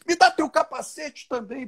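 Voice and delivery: high, excited put-on voice of an amazed friend; imitando o Roberto empolgado. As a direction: squeal it like a kid getting a new toy. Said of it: "Ele só falava assim". (imitando o amigo encantado, voz bem aguda)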